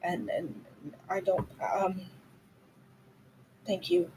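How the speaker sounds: softly, haltingly, feeling for the words; hesitant thanks. Acted. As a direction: trail into a small, soft thank you. Halting, emotional thanks in character.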